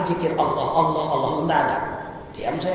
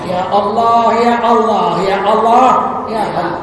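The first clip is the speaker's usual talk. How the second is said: calling out to God; 7 dB louder, slower than usual. Shown as a demonstration. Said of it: "Bagaimana panggil Allah?".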